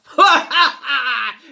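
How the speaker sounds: huge, high, loud fiend's laugh; booming laugh. A narrator's reading. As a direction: throw back your head and roar with laughter. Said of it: "He laughed a great laugh".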